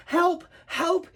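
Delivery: high, urgent calls; short high cries for help; calling for help. Acted. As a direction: call out for rescue. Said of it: "he called".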